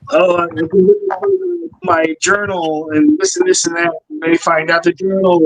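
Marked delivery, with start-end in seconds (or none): 0.1-3.9 ghost voice. Retold or imitated. Spirit answering psychic kid.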